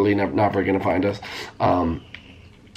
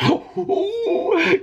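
high laughing gasp; gleeful gasp. Real, not acted. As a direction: squeal with delight. Gleeful reaction.

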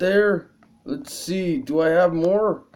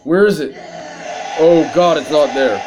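ordinary talk, nothing marked

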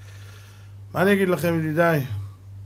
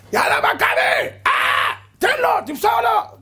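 loud, high stadium yell; fan shouting; 6 dB louder than usual. Retold or imitated. Preacher imitating a fan yelling at the pitch, loud shout. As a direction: cheer on the team at full volume.

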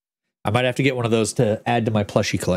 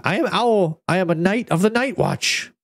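1 brave little owl; 6 semitones higher than usual. A narrator's reading. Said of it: "puffed out his feathers and said".

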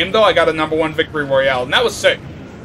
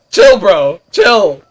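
yelling; 2 semitones higher, 6 dB louder, much slower than usual. Real, not acted. Yelling at enemy player.

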